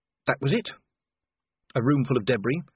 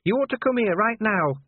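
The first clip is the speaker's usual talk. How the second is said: high, urgent character voice; Cheery; 11 semitones higher, 4 dB louder than usual. A narrator's reading. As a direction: urge the boss to come, pitched right up. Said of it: "beckoning urgently".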